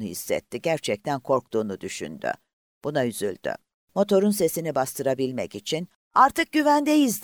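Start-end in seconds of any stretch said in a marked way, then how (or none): 6.1-7.2 high, loud, slow yell over a roaring motor; slow, high shout of relief; shouted over the engine. A narrator's reading. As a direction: call it out high above the engine noise. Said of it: "diye bağırdı".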